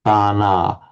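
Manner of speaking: "Pana" with both syllables long and even; equal vowel length. Shown as a demonstration. Teacher showing the correction, "Pana" with two long vowels. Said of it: "give them equal length".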